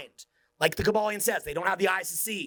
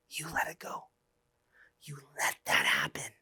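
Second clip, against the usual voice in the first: whisper; 13 semitones lower, 4 dB quieter than usual. Real, not acted. Solemn rebuke to his audience.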